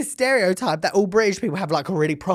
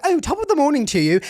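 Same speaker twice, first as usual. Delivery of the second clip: higher, hammed-up British TV accent; obnoxiously British; 7 semitones higher than usual. Mocking TV's fake Brits, obnoxiously British accent. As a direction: overdo the British cheer. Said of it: "putting on a different accent".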